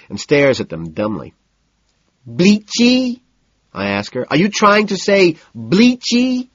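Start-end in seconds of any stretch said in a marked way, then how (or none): 2.3-3.2 high, loud disbelieving echo; a baffled high yelp of a question; incredulous. A narrator's reading. Narrator mimics dry cleaner. 4.3-6.5 high, loud and slow disbelief; a high, slow, stunned question; incredulous. A narrator's reading.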